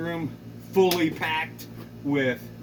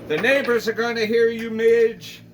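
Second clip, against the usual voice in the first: louder, higher call across the room; shouting; 6 semitones higher, 5 dB louder than usual. Acted. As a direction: shout over the gunfire. Shouting at Midge mid-fight.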